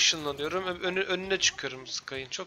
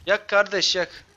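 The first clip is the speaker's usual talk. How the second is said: loud, high cheering; excited cheering; 6 semitones higher, 8 dB louder than usual. Real, not acted. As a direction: urge them on, fired up. Cheering on a teammate.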